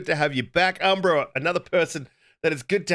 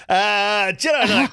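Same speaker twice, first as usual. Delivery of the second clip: hyped announcer call; 5 semitones higher, 5 dB louder, slower than usual. Acted. Hyped host intro for guest.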